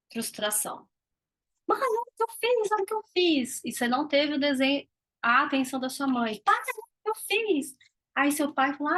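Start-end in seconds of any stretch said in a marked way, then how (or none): 1.7-3.6 put-on high, eager child calling mom; a little kid begging for attention; child's voice. Child showing drawing again. 6.4-7.8 put-on high child calling dad; a kid asking dad to look; child's voice.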